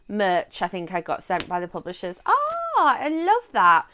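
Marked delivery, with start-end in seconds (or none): 2.3-3.9 high, loud gleeful ah; delighted squeal. Real, not acted. Admiring the cover, high delighted gush.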